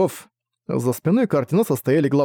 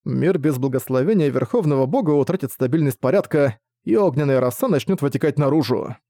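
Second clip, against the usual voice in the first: ordinary delivery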